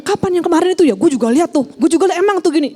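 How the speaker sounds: high, loud eager chiming in; gossipy agreement. Retold or imitated. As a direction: egg the complaint on, stoking the fire. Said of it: "Jangan nanya". (ikut menggosip dengan nada tinggi)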